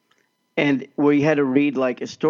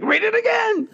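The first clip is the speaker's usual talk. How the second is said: very high mock exasperated teacher. Mrs Webb losing patience with the boy, in a very high mock exasperated voice. Teacher angrier on each retry.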